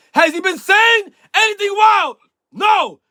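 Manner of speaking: high, loud showy shout; mock shout. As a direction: belt the question like a challenge. Building rant, same question repeated.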